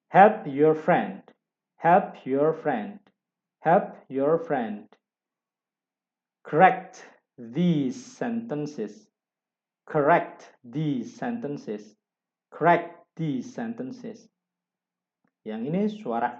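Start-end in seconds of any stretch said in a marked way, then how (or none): 0.1-4.9 Help your friend said very slowly and loudly; slow model reading. Shown as a demonstration. Teacher reading an example sentence for students, very slowly. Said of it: "Saya coba baca". 6.4-14.3 slow model reading. Shown as a demonstration. Teacher modelling a sentence for students, very slowly. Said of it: "Saya coba baca".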